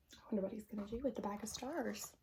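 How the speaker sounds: usual speaking voice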